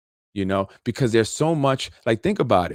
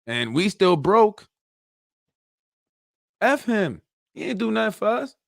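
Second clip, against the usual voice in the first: rising indignation; 8 semitones higher than usual. Acted. Angry outburst at useless leaders.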